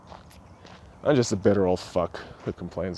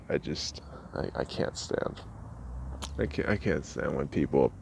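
whispered; 8 semitones lower, 10 dB quieter than usual. Real, not acted. Quiet disgust at fat people.

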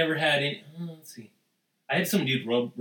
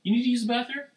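high, loud creepy come-on; sleazy invitation; 12 semitones higher, 3 dB louder than usual. Retold or imitated. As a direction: lure him in, voice pitched up. Acting out Spacey-type approach.

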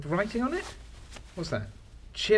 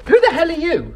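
high, loud demand; startled shout; 11 semitones higher, 9 dB louder than usual. Acted. Man startled by a stranger in his cabinet, shouting who he is. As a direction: bark it at the intruder.

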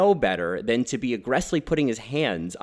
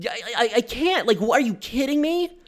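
high, incredulous stammer of outrage; higher exasperated are you kidding me; exasperated disbelief; 11 semitones higher than usual. Real, not acted. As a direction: sputter in real disbelief. Disbelief at the film.

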